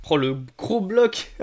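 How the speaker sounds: slow, loud and high; excited. Real, not acted. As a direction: savor the big moment. Reacting to the big card pack.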